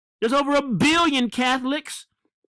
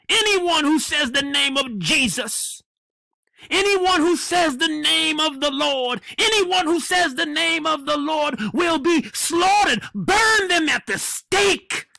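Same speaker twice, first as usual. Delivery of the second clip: preaching shout; 4 semitones higher than usual. Real, not acted.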